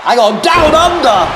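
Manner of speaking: high, slow, loud cry of "Hang on, down under!"; excited call. Real, not acted. Commentator calling the spot to viewers, excited high shout. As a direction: bellow the call as the move lands. Commentator calls a big move.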